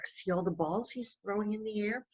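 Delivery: ordinary delivery, nothing marked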